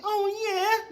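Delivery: high, hammed-up wrestler moan; mock the moaning guy on TV. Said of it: "dude up here moaning on T V".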